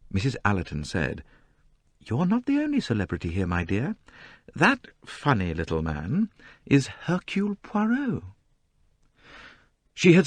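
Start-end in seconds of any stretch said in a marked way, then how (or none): 2.0-8.2 lightly voiced gentle mother; tossed off lightly, socially; a hint of an amused older woman; light older lady. A narrator's reading. Mrs Allerton voiced lightly.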